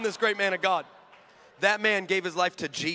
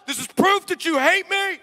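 mock complaint to God; 10 semitones higher, 4 dB louder than usual. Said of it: "I've told God".

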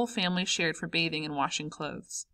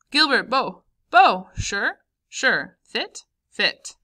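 loud, higher, punchy call and response; lively greeting exchange; 4 semitones higher, 8 dB louder, much slower than usual. A narrator's reading. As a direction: trade slang greetings with a friend. Two friends' ritual greeting.

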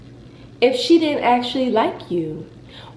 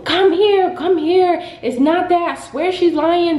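higher, louder urgent calling after someone; mock pleading partner; 8 semitones higher, 3 dB louder than usual. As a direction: plead urgently, imitating a partner giving chase. Partner chasing her, pleading.

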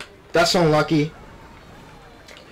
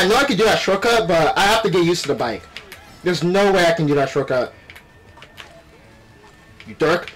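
ordinary delivery

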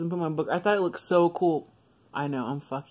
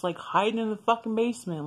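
normal talking voice

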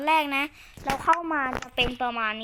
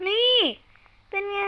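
excited exclaim; 8 semitones higher, 9 dB louder than usual. Real, not acted. Showing off, sudden loud exclaim.

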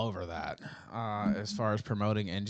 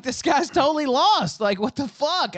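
much higher and louder, fed up and disbelieving; exasperated outburst; 9 dB louder than usual. Retold or imitated. Cohost hearing Rand's claim, loud exasperated outburst. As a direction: blurt it out like you can't believe what you're hearing. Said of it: "I'm like".

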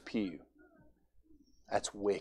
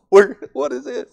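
put-on high, squeaky confused little girl; imitating a bewildered young girl; 13 semitones higher, 9 dB louder than usual. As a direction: play the lost kid at the altar. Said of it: "She's like".

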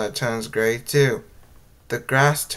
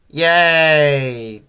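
cheering; 3 semitones higher, 6 dB louder than usual. A narrator's reading. Crew reaching Desert Hills, cheering loudly. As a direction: cheer it out loud. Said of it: "the crew cheered".

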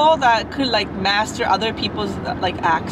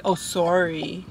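slow, low Canadian "soary"; lightly imitated Canadian long o in SORRY; Canadian accent; 6 semitones lower, slower than usual. Showing how Canadians apologize, Canadian accent. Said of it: "Canadians say that's so weird to me".

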